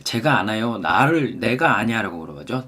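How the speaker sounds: normal talking voice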